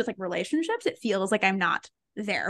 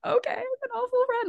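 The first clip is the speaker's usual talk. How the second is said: much higher, hammed-up crying whine; mock whiny cry; 14 semitones higher than usual. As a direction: whimper it like a fake sob of defeat. Retold giving in to friends' accusation.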